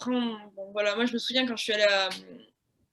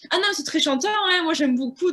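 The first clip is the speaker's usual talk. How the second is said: gushing interviewer; 6 semitones higher, 5 dB louder than usual. Retold or imitated. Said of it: "à chaque fois, c'était".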